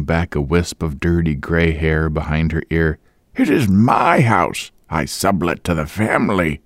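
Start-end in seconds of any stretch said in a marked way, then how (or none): 3.4-6.6 put-on old woman voice. A narrator's reading. Landlady explaining the sublet to Boyce, old-woman voice. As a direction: proudly claim the house.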